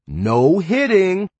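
high, loud, drawn-out singsong scolding; mock-stern parent to a toddler, very slow. A narrator's reading. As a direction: stretch it out and lift it, as if telling off a small child. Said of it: "like I was scolding a toddler".

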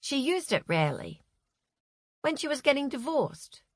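usual speaking voice

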